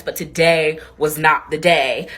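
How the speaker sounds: today was not the day, said slowly; slow, weighty storyteller line; slow dramatic. Real, not acted. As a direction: drag it out for drama.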